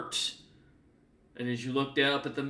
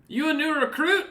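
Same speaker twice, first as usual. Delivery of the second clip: much higher, louder NPC voice; Scartsmander's voice; 14 semitones higher, 6 dB louder than usual. Acted. Scartsmander questioning the new arrival in a high, bright voice. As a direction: ask the newcomer brightly. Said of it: "looks up at Zek and goes".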